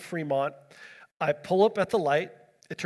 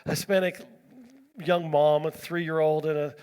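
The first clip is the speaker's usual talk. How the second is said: normal talking voice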